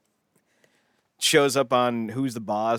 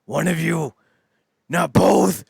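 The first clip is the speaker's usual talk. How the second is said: loud, rising mock panic; hammed-up meltdown, pitch climbing; freaking out; 7 semitones higher, 6 dB louder, much slower than usual. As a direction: freak out louder with each phrase. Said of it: "he just starts freaking out".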